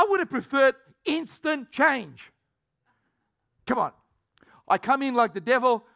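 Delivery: ordinary talk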